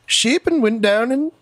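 high-pitched put-on Irish accent. Shown as a demonstration. Doing the assigned Irish accent round the table, comic Irish accent.

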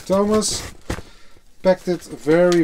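ordinary talk, nothing marked

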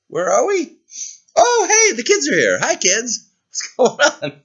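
high, perky little voice, suddenly awake; a character waking up and spotting the kids, pitched high. Acted. Character wakes, greets the kids.

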